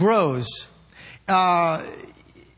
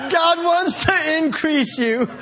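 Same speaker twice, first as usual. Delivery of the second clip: slow, higher, laughing through it; 6 semitones higher, slower than usual. Real, not acted. Preacher to congregation, laughing. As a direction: try to preach through giggles.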